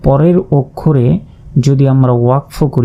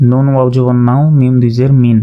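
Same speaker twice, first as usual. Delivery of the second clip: usual speaking voice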